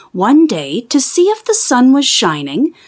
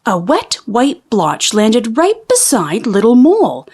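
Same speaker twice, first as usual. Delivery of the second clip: plain reading voice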